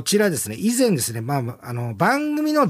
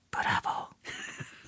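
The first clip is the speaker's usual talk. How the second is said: whispered, drawn-out bravo; small voice; 15 dB quieter, much slower than usual. Shown as a demonstration. Presenter demonstrating the soccer catchphrase for listeners, in a small whisper. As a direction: say it in a tiny whisper. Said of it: "小さい声で言いますね".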